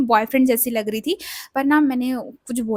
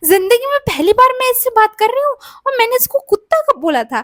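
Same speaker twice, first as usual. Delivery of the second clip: exasperated protest; 10 semitones higher, 5 dB louder than usual. Retold or imitated. Protesting she never said it.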